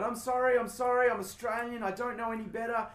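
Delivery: high, gushing apology; apology mode. Retold or imitated. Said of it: "I went into apology mode".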